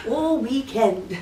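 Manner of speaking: slow, laughing mock British drawl; hammed-up Cockney-ish slowness; mock British accent. Interviewer imitating Vin's British phrase, slow and laughing. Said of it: "He was going".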